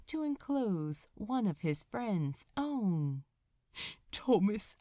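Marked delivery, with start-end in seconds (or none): 4.1-4.7 breathy whispered name. Acted. Angel whispers his dying friend's name.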